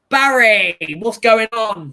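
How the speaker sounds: loud, high outcry; loud exasperated outcry; dismayed shout. Real, not acted. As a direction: shout in disbelief. Outraged at disallowed goal.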